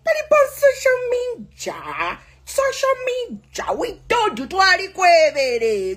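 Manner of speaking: scolding shout. Real, not acted. Scolding rival on social media.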